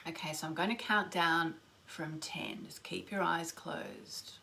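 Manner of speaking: whispered, slow guided meditation; slow soothing countdown. Acted. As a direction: count them down softly into stillness.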